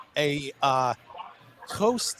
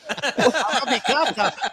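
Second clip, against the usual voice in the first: laughing attempt; 5 semitones higher, slower than usual. Real, not acted.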